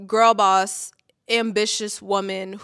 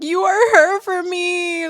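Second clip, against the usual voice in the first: high, slow and louder, gushing; 9 semitones higher, 6 dB louder, slower than usual. Retold or imitated. Replying to friend Katie, high heartfelt gush. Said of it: "I was like".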